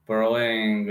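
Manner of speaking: ordinary delivery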